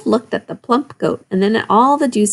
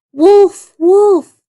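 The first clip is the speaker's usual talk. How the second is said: high loud shout of wolf; yelling; 11 semitones higher, 8 dB louder than usual. A narrator's reading. Boy yells for help across the meadow, loud call. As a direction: shout it out to warn the village. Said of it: "the boy yelled out again".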